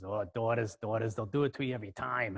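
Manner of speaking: knowing New York aside, imitated; New York accent. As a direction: commiserate as a New York mechanic. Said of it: "this kinda New York accent".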